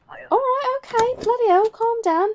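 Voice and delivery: squeaky mock protest; flustered retort. Exasperated entrant or officer answering back at the booth, high-pitched mock protest. Acted retort in quarrel at border.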